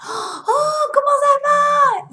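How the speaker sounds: high, slow delighted "oh, comment ça va?"; light up at a long-lost friend; surprised greeting. Retold or imitated. Retrouve un vieux copain. (surprise joyeuse, aiguë et lente)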